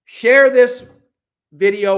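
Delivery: loud, high urgent call. Real, not acted. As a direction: shout it to everyone watching. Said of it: "So listen".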